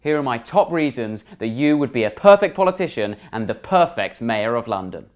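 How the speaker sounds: ordinary talk, nothing marked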